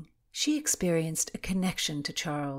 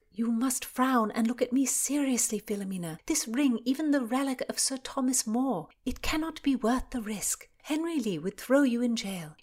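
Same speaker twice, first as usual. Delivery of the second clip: put-on higher-pitched young friend, worried and firm; earnest Constance, warning; 7 semitones higher than usual. Constance warns her friend off the risky search, earnest. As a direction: plead the case in a raised, urgent pitch.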